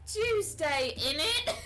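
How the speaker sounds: mock British accent. Streamer teasing viewers with a joke, mock British accent. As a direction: belt out a hammed-up British catchphrase. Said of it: "I will do a British accent".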